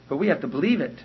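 ordinary delivery

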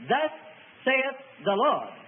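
slow, word-by-word pronouncement; solemn pronouncement. Shown as a demonstration. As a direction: pronounce it slowly, with pauses between phrases. Quotes a solemn prophetic formula.

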